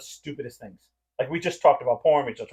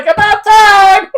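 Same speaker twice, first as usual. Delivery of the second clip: high, loud, very slow mock yell; sister's shout; 15 dB louder, much slower than usual. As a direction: drag it out like a shout through the wall. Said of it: "Her sister was like".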